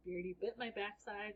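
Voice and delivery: usual speaking voice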